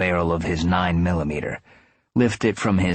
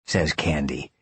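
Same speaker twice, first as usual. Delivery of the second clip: ordinary delivery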